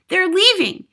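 put-on high, loud distraught child; cried out. A narrator's reading. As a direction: cry out urgent news. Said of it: "she cried".

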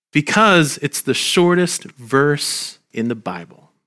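ordinary delivery

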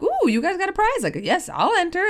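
high, bouncy mock delight at each booth; playful eagerness. Walking past conference booths with a friend, playful high delight. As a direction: act giddy about every prize. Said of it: "we're like".

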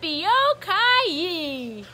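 ordinary delivery

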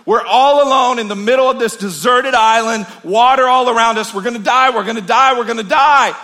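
loud, high panicked rant; freaking out. Acted. Stranded man freaking out at his calm friend, shouting high. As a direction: spiral into panic, pleading. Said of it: "And the guy's freaking out".

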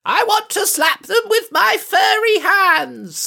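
high-pitched mock battle shout; high comic shout. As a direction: yell it like an eager fool. Shouting the invented line.

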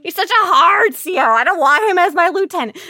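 high, loud mocking whine; hammed-up complaining voice; whiny mock complaint. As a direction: parody the people who hate him. Mimicking haters' complaints.

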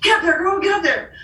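high, eager push to go on stage; excited urging. Retold or imitated. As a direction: cheer her up to the stage. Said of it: "And Robin Lee Hatcher's like".

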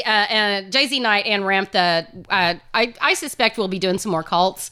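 ordinary talk

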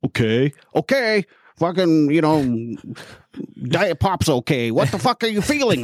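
imitating an exasperated counselor, pitch climbing high; mock-exasperated, high-pitched demand; swearing old-school counselor. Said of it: "counselors swearing at you and stuff".

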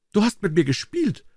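high-pitched reproach to a sweetheart; accusing swallow. A narrator's reading. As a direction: cry out the accusation in a high bird voice. Swallow accuses his beloved, calling out.

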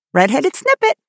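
high parrot squawk, rising at the end; ejaculating parrot. A narrator's reading. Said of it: "kept ejaculating".

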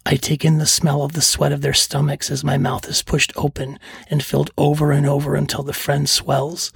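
plain reading voice

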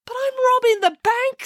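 very high, exasperated whine; protesting bank robber. Acted. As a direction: protest in baffled, high-pitched disbelief.

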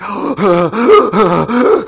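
rapid, noisy gulps of air; mock hyperventilating gasps. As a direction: gasp over and over like an overwhelmed fan.

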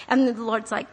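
ordinary delivery

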